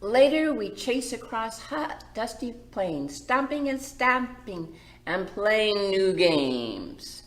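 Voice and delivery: bright, lilting story read; gentle read-aloud for the little ones; reading to children. A narrator's reading. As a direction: read it like a picture book to kids. Reads picture book aloud.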